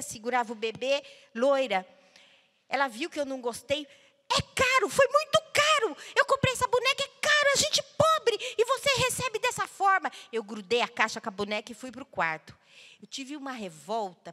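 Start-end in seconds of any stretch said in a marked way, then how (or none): 4.3-10.1 much higher, pleading mother's voice; hurt mother. Retold or imitated. Mother protesting to daughter about the costly doll, high and hurt. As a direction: voice the poor mother, stung and pleading.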